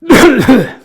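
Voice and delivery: loud throaty cough; acted cough. Man in the corner smoking and coughing away, loud hacking cough. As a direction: cough loudly, acting out the smoker. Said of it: "coughing away".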